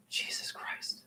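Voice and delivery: breathy whisper of despair; hushed disbelief; whispered dismay. Real, not acted. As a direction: whisper it, appalled. Thinking of roadkill.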